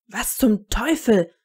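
loud, drawn-out exclamation; called out. A narrator's reading. Harry catches sight of the cabinet, loud exclamation. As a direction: cry it out slowly in disbelief. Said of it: "rief Harry".

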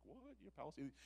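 high-pitched mock astonishment; disciples' disbelief. Disciples reacting to Jesus talking to this girl, high-pitched mock astonishment. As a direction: shoot "what" way up in acted disbelief. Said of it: "They're like".

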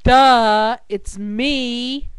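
loud punchline DUH; exasperated duh. Acted. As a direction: blurt it like it's obvious. Blonde's punchline to friend.

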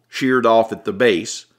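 ordinary delivery, nothing marked

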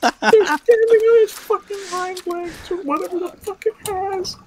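high, slow, half-crying panic; scared horror game streamer. Real, not acted. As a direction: wail it out in shaky fear. Terrified explaining.

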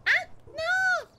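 high loud cry; dismayed shout. Real, not acted. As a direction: yell as you fall. Shout on falling.

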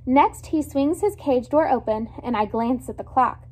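ordinary delivery, nothing marked

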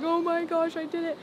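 giddy, overjoyed self-quote; excited disbelief. Retold or imitated. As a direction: relive the moment you heard the news. Retelling joyful call from manager.

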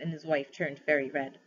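usual speaking voice

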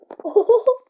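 loud high laugh bursting out; sudden loud laughing fit; burst of laughter. Real, not acted. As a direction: crack up loudly at the screen.

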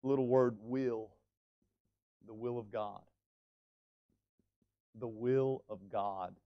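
will, low and quiet, held; stress on will. Shown as a demonstration. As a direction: dwell on will softly. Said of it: "what is being emphasized".

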